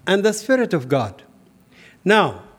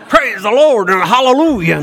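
loud, high hallelujah shout; Southern Baptist preacher; 5 semitones higher, 8 dB louder than usual. Acted. Friend suddenly turning Southern Baptist preacher, booming praise. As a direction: belt it out like a revival preacher. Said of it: "a Southern Baptist preacher preaching".

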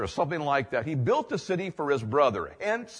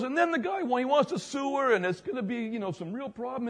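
much higher, astonished retold voice; amazed man; 10 semitones higher than usual. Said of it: "And he goes".

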